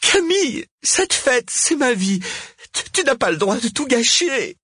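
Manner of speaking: very high, anguished protest; distraught pleading. A narrator's reading. Cosima telling Camille not to ruin her party, distraught. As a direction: protest as everything you live for collapses.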